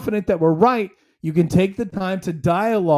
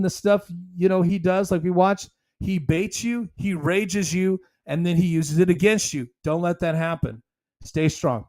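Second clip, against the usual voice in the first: ordinary talk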